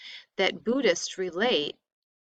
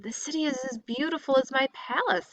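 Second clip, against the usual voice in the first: higher and fast, a lightly voiced delighted prince; delighted exclaiming; 7 semitones higher, faster than usual. A narrator's reading. Young prince marvels at the city to his driver, delighted exclaiming. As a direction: gush over the city like an amazed young prince. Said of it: "he exclaimed".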